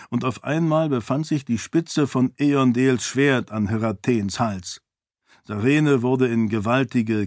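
ordinary delivery, nothing marked

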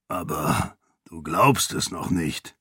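put-on low, soft rasp of an older man; a light gravel-voiced uncle; deep rasping voice. A narrator's reading. Uncle Kiin telling his niece she doubts it, low rasp. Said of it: "mit seiner tiefen Raspelstimme".

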